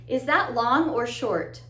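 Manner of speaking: normal talking voice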